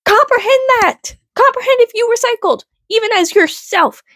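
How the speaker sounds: worked-up high-pitched insistence; exasperated plea. Real, not acted. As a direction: beg them to grasp it. Exasperated demand.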